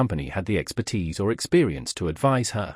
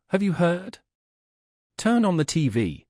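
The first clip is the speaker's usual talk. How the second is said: higher-pitched urgent question, then instruction; urgent news; 6 semitones higher than usual. A narrator's reading. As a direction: break the news with restrained urgency. Colleague brings alarming news from doorway.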